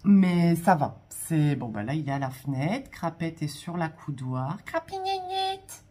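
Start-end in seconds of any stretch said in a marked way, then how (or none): 4.6-5.8 cooing to cat. Real, not acted. Calling cat by pet name.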